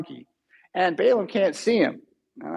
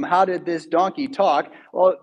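normal talking voice

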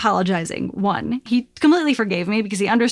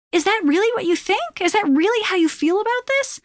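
much higher-pitched imitated questioning; retold challenging question; 10 semitones higher than usual. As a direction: voice the friend's doubting text, pitched high. Retold hurt text from friend.

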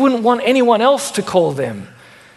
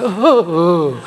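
slow, drawn-out mock groan; hammed-up kid exasperation, drawn out; exasperated kid groan; 2 semitones lower, slower than usual. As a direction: groan like a fed-up child. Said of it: "then kids get exasperated".

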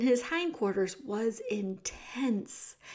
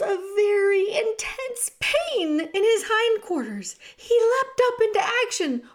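high, loud, thrilling storytelling; urgent narration; 9 semitones higher, 5 dB louder than usual. A narrator's reading. Narrator tells of Despereaux fleeing the knife, urgently excited. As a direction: narrate the chase at a high pitch, driving on. Knife falls, mouse flees for life.

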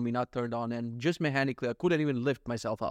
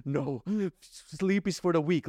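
put-on baby voice; slower than usual. Baby voiced fighting sleep.

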